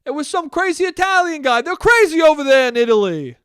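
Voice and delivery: high, loud hammed-up dad; mock dad hollering in disbelief; dad impression. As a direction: exclaim it like an exasperated dad. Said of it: "my dad was like".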